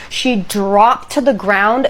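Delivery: usual speaking voice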